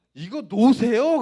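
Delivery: loud, high, drawn-out protesting shout; acting out a son yelling to be let go; defiant outburst. Son being shaken by his mother, shouting defiantly. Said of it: "그러면서 엄마에 대들었어요".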